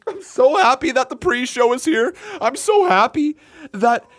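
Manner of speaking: high-pitched, hammed-up happy weeping; mock crying. Streamer with watering eyes joking to viewers, mock crying. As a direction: fake being overcome with tears, loud and high, easing off at the end. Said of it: "Con Man's crying".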